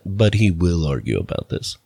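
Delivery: low, dry narrator aside; Ron Howard narrator. Retold or imitated. Comic cutaway to the narrator, low deadpan. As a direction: deliver it like a documentary narrator cutting in. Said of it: "I'll cut to Ron Howard".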